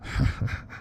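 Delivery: quiet breathy villain chuckle; evil chuckle. A narrator's reading. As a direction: chuckle darkly under the breath. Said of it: "Freddy chuckled".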